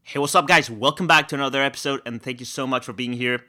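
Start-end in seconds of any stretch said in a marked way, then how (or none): none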